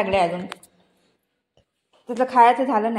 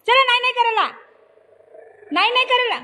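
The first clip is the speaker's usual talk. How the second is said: raised scold; 10 semitones higher, faster than usual. Real, not acted. Scolding the child, high pitched. (मोठ्याने दटावणे)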